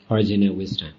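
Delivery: ordinary talk, nothing marked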